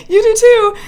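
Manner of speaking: high, loud retold giggle-talk; giggly reply. Flirting back at the neighbour, giggly high re-enactment. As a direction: blurt it out through a giggle, mocking yourself. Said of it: "I looked at that man and I giggled".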